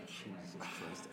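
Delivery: slow, hushed, appalled murmur; imitated quiet dismay; dismayed aside. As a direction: say it low and slow under your breath. Acting Robson's dismayed reaction to joke.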